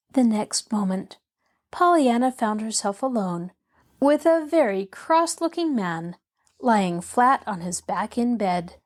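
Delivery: ordinary delivery, nothing marked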